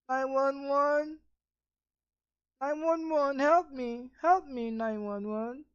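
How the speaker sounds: mock a grown man begging the police; a hint of a helpless caller; whimpering 911 caller. Mocking crying 911 caller.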